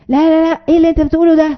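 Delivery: mock scolding shout; angry parent yelling. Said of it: "قعد يزعق".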